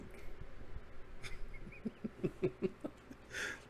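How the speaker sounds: long, high, breathy laughing fit. Real, not acted. Host amused that they can't loot, laughing hard. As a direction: crack up for real. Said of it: "I shouldn't be laughing".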